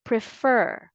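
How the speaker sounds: "Prefer" said pre-FER, the second syllable stressed. Shown as a demonstration. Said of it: "stress the second syllable".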